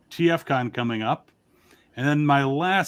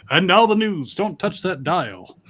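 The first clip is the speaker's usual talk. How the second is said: broadcaster; 4 semitones higher than usual. Shown as a demonstration. Host demonstrating his generic American accent, mock broadcaster voice. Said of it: "generic broadcaster style English".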